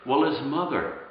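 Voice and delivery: ordinary delivery, nothing marked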